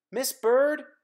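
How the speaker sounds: loud, high call; crying out. Acted. Simon calling to a passing bird, loud and high. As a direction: shout up to the bird. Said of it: "cried Simon".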